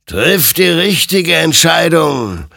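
a grave admonition from the creature; admonishing. A narrator's reading. Creature warning Hector, slow and solemn. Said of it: "ermahnte ihn sein Gegenüber".

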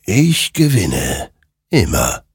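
slow, deliberate victory claim; lightly voiced soul creature. A narrator's reading. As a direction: lightly play a creature stating it slowly, word by word.

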